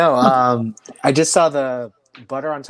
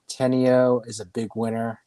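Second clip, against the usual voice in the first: ordinary talk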